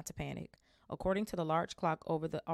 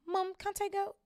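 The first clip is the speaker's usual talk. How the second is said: put-on much higher, whiny little-girl plea; pleading girl. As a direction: beg mum like a small child.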